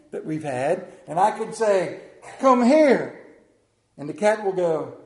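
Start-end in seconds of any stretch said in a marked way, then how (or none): 2.4-3.3 loud, raised-pitch order to the cat; commanding call. Retold or imitated. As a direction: call the cat over forcefully. His own call to the cat.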